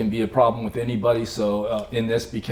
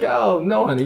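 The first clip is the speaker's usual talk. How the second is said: mock brush-off; 9 semitones higher, 4 dB louder than usual. Imitating Berta's imagined brush-off.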